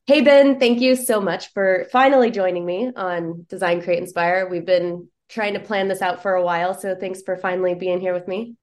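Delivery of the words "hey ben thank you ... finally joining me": excited greeting. Real, not acted. Host greets guest warmly on show.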